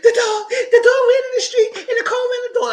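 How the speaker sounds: very high, loud, laughing mock voice; mocking her voice. Mocking Allison's excuse about the dog, high squeaky voice. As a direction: squeak out the lie in a silly high voice. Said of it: "and she said".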